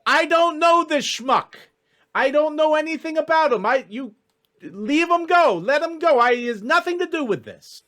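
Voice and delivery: very high, agitated voice; high-voiced, frantic warning; Jack. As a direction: protest urgently in a strained high pitch. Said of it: "you hear Jack's voice going".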